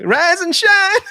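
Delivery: high, loud mock wake-up call; laughing shout. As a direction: shout it out gleefully through laughter. Quoting film line amid laughter.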